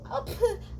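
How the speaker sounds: a high, half-crying laugh of disbelief; cracked exasperated laugh. Real, not acted. Laughs mid rant.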